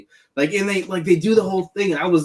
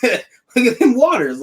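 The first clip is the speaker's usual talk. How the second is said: laughing mock warning; 9 semitones higher, 5 dB louder, slower than usual. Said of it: "she was like".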